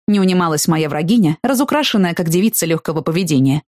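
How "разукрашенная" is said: usual speaking voice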